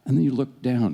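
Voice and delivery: ordinary talk, nothing marked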